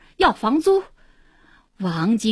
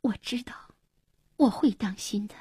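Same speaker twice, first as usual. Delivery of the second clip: gentle, hushed assurance; quietly reassuring, held back; soft reply; 6 dB quieter than usual. A narrator's reading. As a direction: answer softly, close to tears. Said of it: "轻声说".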